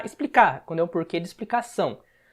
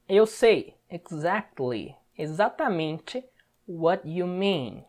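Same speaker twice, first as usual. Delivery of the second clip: slow teaching; slower than usual. Shown as a demonstration. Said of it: "pra você prestar um pouco mais de atenção".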